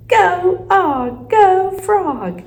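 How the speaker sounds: loud, high, drawn-out urging; yelled cheering. A narrator's reading. Hamster urges frog onward, yelling. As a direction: holler each word to spur on a mount. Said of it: "I yelled".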